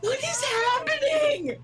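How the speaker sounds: high, drawn-out wail of disbelief; mock-distraught, stretched squeal; bewildered outcry. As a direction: cry it out slowly, way up high. Shocked reaction to the shooting.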